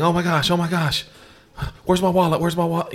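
husband mimicking wife's frantic wallet hunt; imitating panic. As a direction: play her scrambling for the wallet. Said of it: "she's panicking".

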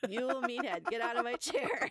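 high, put-on foreign accent; hopelessly bad impression of a grouch in a foreign accent; Foreign Man accent as Archie Bunker. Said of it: "Archie Bunker".

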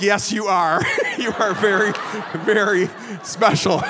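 chuckling, humouring reply; amused agreement. Retold or imitated. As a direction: agree through laughter, slowing on the last words. Students humouring the odd professor.

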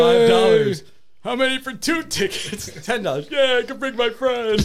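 high, goofy prank-call voice, giggling through it; silly prank caller voice. Retold or imitated. Retelling a crank call to friends, high goofy voice. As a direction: play the dim caller, laughing at the bit.

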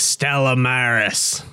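slow, low like a ship's captain. Retold or imitated. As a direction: announce it like a sea captain. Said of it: "why did you say it like a ship's captain".

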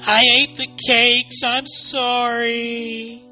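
very high, drawn-out wail; wailing confession. Acted. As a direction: let the last word stretch into a long wail. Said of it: "she wailed".

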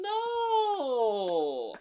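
long, high wailed cry of disbelief; dismayed protest. Real, not acted. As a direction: wail it high, stretched out and falling at the end. Protests guest's self-criticism.

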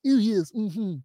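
high, put-on prim agreement; affected high voice playing along; mock agreeable delegate. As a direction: send up an eager nodder, high-pitched. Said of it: "I'm not sitting there like".

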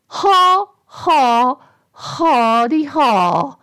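high, slow mock laugh; triumphant. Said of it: "said the voice triumphantly".

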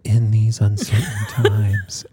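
slow, put-on ad announcer voice breaking into a laugh; hammed-up somber ad voice, cracking up; mock commercial jingle. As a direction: parody a fast-food ad read, slowly. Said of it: "McDonald's commercials".

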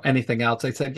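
usual speaking voice

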